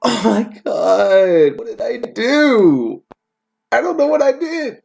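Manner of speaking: hammed-up falsetto crying, very high and slow; fake-sob it like a wounded child; mock wailing. Reader fake-wailing after being blamed.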